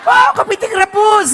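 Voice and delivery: loud, high, yelled food insult; hammed-up furious shout; mock angry Batak curse. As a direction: yell it like a curse. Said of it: "gitu ya kan".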